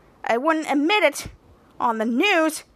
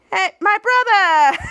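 laughing greeting; 6 semitones higher, 5 dB louder, slower than usual. Retold or imitated. Shout Out greets loudly, laughing.

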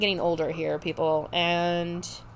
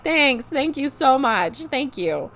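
higher, louder mock-grateful thanks; sarcastic thanks; 4 semitones higher, 4 dB louder, slower than usual. Answering her sister's teasing with loud sarcastic thanks. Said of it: "And I'm like".

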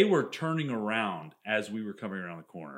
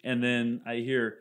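ordinary talk

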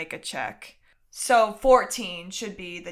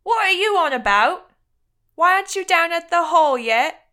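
high, loud challenge in a put-on voice; suspicious boy; 8 semitones higher, 9 dB louder than usual. Suspicious boy challenges the disguised newcomer, high loud character voice. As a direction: demand an explanation, loud and suspicious. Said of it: "looks at you with narrowed eyes and says".

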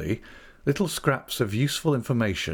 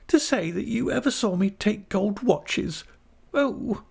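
high-pitched, indignant exclamation; much higher voice, scandalised; shocked young wife; 12 semitones higher than usual. A narrator's reading. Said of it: "exclaimed Dora, opening her eyes wide".

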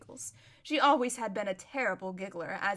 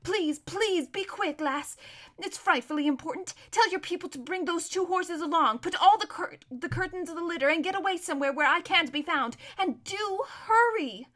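urgent, high-pitched pleading; pressing, desperate plea; frantic runaway girl; 6 semitones higher, 3 dB louder than usual. Acted. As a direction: beg and order her to hurry.

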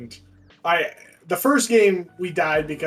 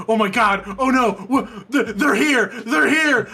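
higher, louder, stammering alarm; mock panic; 5 semitones higher, 3 dB louder than usual. Imitating teammate in the first dog round, mock panic. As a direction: build into a panicky shout, half laughing. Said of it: "starts panicking".

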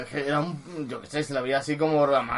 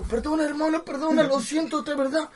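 apologetic plea; 11 semitones higher than usual. Retold or imitated.

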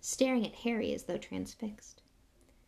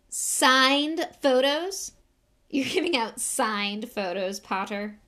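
drawn-out scathing disbelief; mocking schoolboy bully; loud and scathing; 4 semitones higher, 7 dB louder, slower than usual. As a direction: open loud and high, then settle into a sneer. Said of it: "Loud and scathing".

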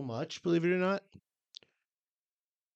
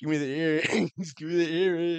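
mock thug voice; 5 semitones higher, 4 dB louder than usual. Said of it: "investigator demanding earrings".

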